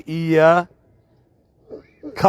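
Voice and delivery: ایاک drawn out very slowly, every sound clear; slow model reading. Shown as a demonstration. Teacher showing an easy slow way to read. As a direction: stretch ایاک slowly for the learner. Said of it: "آسانی کے لیے آپ یوں پڑھیں گے".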